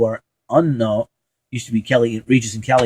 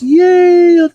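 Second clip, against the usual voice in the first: high, loud drawn-out "yay"; cheering; 7 dB louder than usual. Pretend. Fan rooting for the Pats, high cheering. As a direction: cheer loudly for the team.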